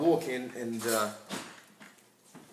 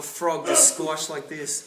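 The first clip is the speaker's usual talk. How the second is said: laughing; 2 semitones lower, slower than usual. Real, not acted. Laughing about the dead frog.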